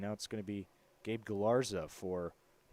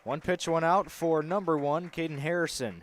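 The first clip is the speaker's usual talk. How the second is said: play-by-play; 4 semitones higher, 6 dB louder than usual. Acted. Live play-by-play call.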